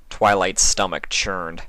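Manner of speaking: ordinary delivery, nothing marked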